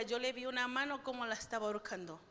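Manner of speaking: ordinary talk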